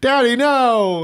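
high, drawn-out playful protest; mock drunk whine. Improvised drunk daughter protests to captain.

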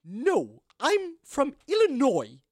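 over-enunciated, staying in character; stately townswoman. Acted. Townswoman explaining her origins, grandly over-enunciated.

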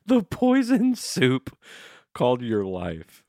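high, slow, theatrical doom voice; mock dramatic recitation. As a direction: intone it like a tortured poet.

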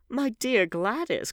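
high, slow, loud exclamation; expostulating, pitched well up; crying out. A narrator's reading. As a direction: exclaim in drawn-out protest. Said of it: "cried Lord Henry".

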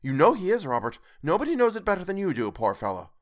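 wife berating Scrooge to her husband; scornful Missus Cratchit. A narrator's reading. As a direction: lash out at the miser. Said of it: "said she".

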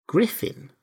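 puzzled, feeling out the name; questioning. A narrator's reading. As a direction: say "Griffin" as a doubtful question.

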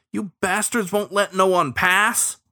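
high-pitched indignant heckle; angry. A narrator's reading. Boater accusing the sheriffs from another boat, angry. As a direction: call out the accusation angrily. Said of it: "an angry voice came from another boat".

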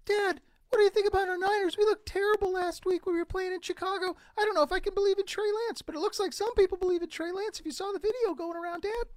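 put-on very high child's voice, fast in the middle; kid asking dad. Son asking dad about the Niners, in a high child's voice. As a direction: play a young fan pestering his father.

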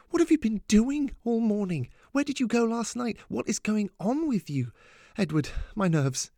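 high-pitched flurry of exasperated questions. A narrator's reading. Mum at her limit with her silent daughter, exasperated. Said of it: "his wife's exasperation".

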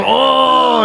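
high, loud mock bellow; one side of duelling hammed-up groans; Mister Sato groan. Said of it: "it's dueling Satos".

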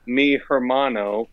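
"hermano" said as her-MAH-no, English H sounded; slow, bad learner Spanish "hermano" starting like "her"; mock a learner sounding the H in "hermano"; H pronounced. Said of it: "people who pronounce the H's".

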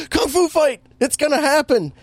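giddy high announcement of the fight; excited fan. Retold or imitated. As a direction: squeal it like a kid at the big moment. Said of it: "this is where I was like".

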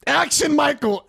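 high, loud mock cue; imitated on-set shout; director calling action. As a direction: call it out like a film director.

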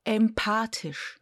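Empathisch said slowly and clearly; spelled right. Shown as a demonstration. Host modelling the correct word slowly. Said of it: "das H kommt nach dem T".

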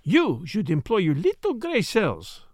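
put-on slow, grave French-accented advice; grave Poirot. Poirot advises his friend gravely, French accent. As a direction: weigh every word like a lesson. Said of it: "said Poirot gravely".

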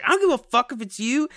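high, defiant mock retort. Imitating a friend refusing again, high defiant retort. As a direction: snap back, pitched high.

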